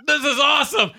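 high, loud excited cry; excited shout. Retold or imitated. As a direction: squeal it like a thrilled fan. Excited selfie shout retold.

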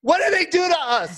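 high, pleading comic protest; mock outrage. Comic defending kangaroos to the diners, mock outrage. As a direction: plead the animals' case, pitched high.

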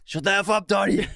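hammed-up quote of an angry friend; mock Walter yell. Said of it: "Don't worry, Donnie".

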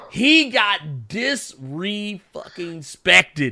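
"dis-re-fucking-spected" spaced out slowly, each syllable hit; slow stressed syllables. Real, not acted. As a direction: spell out "dis-re-fucking-spected" one beat at a time.

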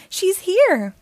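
high, loud flustered warning; Daisy panicking as the star arrives; alarmed cry. A narrator's reading. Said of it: "cries Daisy".

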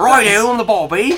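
loud, high Australian cheer; hammed-up Aussie encouragement; Australian accent. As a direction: call it out like a keen Australian mate. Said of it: "Don't know why he's Australian now".

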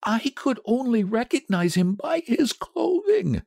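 high, halting, dread-filled; shaking voice. A narrator's reading. Old man warns wife about the mangled body, voice shaking. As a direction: falter over what you dread to tell. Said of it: "his voice shook".

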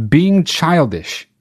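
slow, drawn-out quote; drawn-out sarcastic quote; quoted slowly. Retold or imitated. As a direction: spell out each word with mock weight.

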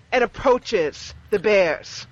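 ordinary talk

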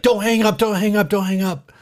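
high, urgent repeated plea; mock-panicked caller begging; frantic pleading. Urgent plea to call center.

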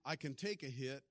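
ordinary talk, nothing marked

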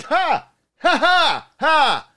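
high, loud, forced ha ha; mock a try-hard laugh to get noticed; loud, high mock laugh of a creep at the bar; obnoxious fake laugh. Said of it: "laughing really loud and obnoxiously".

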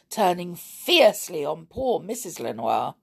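plain reading voice

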